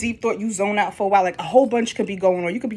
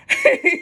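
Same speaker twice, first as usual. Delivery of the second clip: high laugh; 5 dB louder than usual. Real, not acted. Laughs at own imitation.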